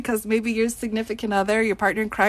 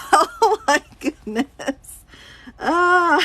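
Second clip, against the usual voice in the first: laughing; 7 semitones higher, 5 dB louder, much slower than usual. Real, not acted. Laughing at viewer comments.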